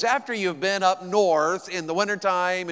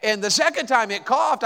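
ordinary delivery

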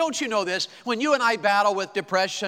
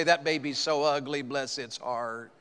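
fake-sweet insult, fading low; mock Southern gossip; 5 semitones lower, 5 dB quieter than usual. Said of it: "They'll say".

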